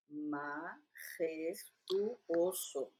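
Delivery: "majestuoso" very slowly, syllable by syllable; modelo de pronunciación. Shown as a demonstration. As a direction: draw out each syllable of "majestuoso" to correct a learner. (majestuoso muy lento, sílaba por sílaba)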